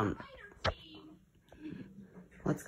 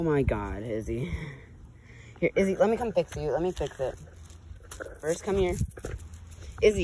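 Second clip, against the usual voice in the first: normal talking voice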